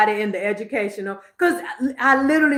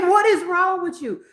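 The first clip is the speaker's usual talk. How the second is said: laughing through quote; 10 semitones higher, 4 dB louder than usual. Retold or imitated. Husband quoted while she laughs.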